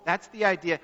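ordinary talk, nothing marked

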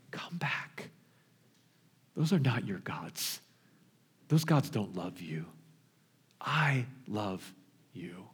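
God whispering to his wayward people; tender whisper. Retold or imitated. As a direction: breathe it like a secret longing.

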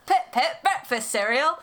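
put-on high, silly British accent; mock British accent. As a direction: poke fun in exaggerated British. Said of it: "I actually used to make fun of that".

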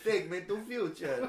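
mock Eastern European accent. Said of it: "Eastern European people".

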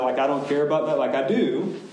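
ordinary talk, nothing marked

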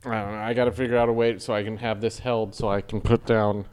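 low, strange alien voice. Pretend. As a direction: talk like a spaced-out alien. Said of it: "Are you doing an alien voice?".

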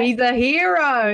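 high, slow, hammed-up praise; drawn-out sarcastic cheer for the hero; mock hero praise. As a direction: echo it back, slow and high in mock admiration. Echoing mock praise of single men.

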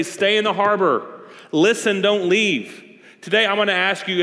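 impassioned sermon appeal; forceful preaching. Real, not acted. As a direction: press the congregation with conviction.

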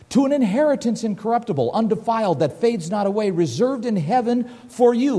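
lifted, quick scripture read. Acted. Preacher reads First Peter to the congregation, proclaiming. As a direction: read the verse out with conviction.

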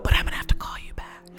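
fast, laughing whisper. Retold or imitated. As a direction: rush the sign-off under your breath. Leaving angry call, laughing.

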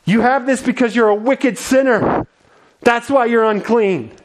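high, accusing condemnation; judgmental shout. Acted. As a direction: throw judgment at the sinner. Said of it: "shouting judgment".

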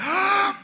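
sharp, high mock gasp; startled gasp. Friends caught in the police lights, startled gasp.

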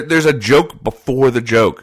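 ordinary delivery

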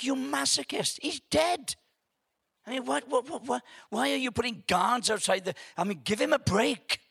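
high-pitched, stammering protest; retold exasperation at the screen; indignant outburst. Angry moviegoer protesting at Jesus' killers, indignant. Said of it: "I'm really angry".

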